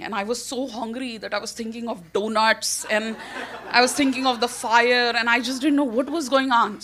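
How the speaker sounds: normal talking voice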